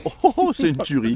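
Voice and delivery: loud, high delight over food; delighted exclamation. Real, not acted. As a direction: exclaim with pleasure while tasting. Tasting delicious pork.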